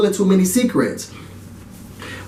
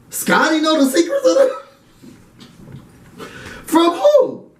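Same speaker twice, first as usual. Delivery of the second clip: "who?" squeaks up very high; slow, laughing disbelief rising to a squeal; incredulous; 10 semitones higher, much slower than usual. Retold or imitated. Incredulous retelling.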